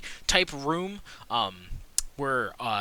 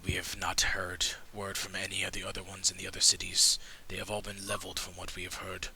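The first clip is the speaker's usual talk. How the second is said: hushed conspirator; 6 semitones lower than usual. Acted. Game master voices a grim conspirator warning the party, in a low whisper. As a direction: lean in and share a dark secret.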